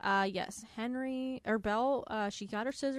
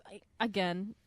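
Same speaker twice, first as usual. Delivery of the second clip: usual speaking voice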